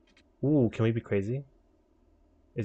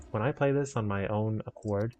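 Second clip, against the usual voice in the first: ordinary talk, nothing marked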